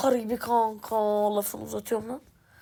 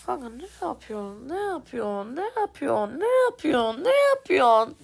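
building exasperation. Real, not acted. Repeating at teammate, rising frustration.

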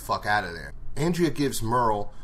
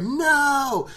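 high, loud cry of alarm; shout; 7 dB louder than usual. Retold or imitated. Group spotting walkers behind them, high loud shout. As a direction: yell as if the walkers just appeared. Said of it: "they're like".

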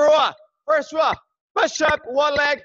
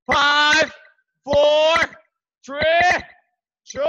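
loud, higher count; shouted countdown; 3 semitones higher, 5 dB louder, much slower than usual. Real, not acted. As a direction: call each number across the room.

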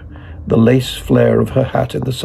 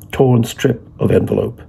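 ordinary delivery

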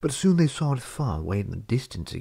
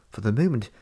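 usual speaking voice

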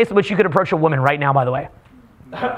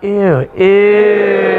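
short "ew", then a long loud one; breathed-out "ew"; 5 dB louder than usual. Shown as a demonstration. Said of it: "Breathe out".

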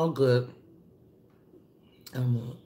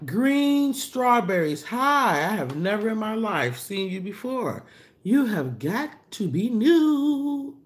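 excited greeting; 6 semitones higher, 4 dB louder, slower than usual. Real, not acted. Greeting a new viewer on live.

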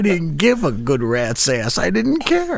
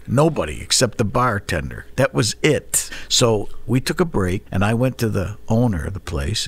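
usual speaking voice

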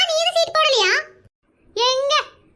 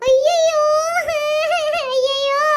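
crying; 3 semitones higher, much slower than usual. Acted. Wife hears the money is gone for good, crying out. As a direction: wail out in despair. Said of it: "நீ எதுக்குமா அழுகுற?".

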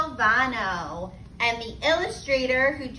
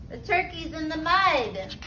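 ordinary delivery, nothing marked

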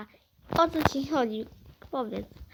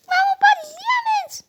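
high, slow thrilled shout; excited shout; 6 dB louder than usual. Real, not acted. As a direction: yell out the find to mom. Dziecko znajduje diament w zabawie. (podekscytowany okrzyk)

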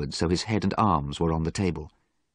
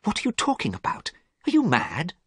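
high, quick, startled questions; alarmed disbelief; 12 semitones higher than usual. Acted. Basil startled by Dorian's strange threat, alarmed. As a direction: blurt it out in shocked alarm.

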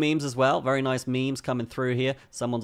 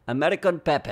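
higher-pitched put-on accent, not really American; 5 semitones higher, 3 dB louder than usual. Reading the coin's name off its website, put-on accent. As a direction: say the name in an odd mock accent. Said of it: "said that in that accent".

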